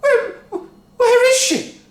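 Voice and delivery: high, loud stammering cry; panicked cry. A narrator's reading. Wife crying out for lost Grandma. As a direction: cry out searching. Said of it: "cried Missus Cranky".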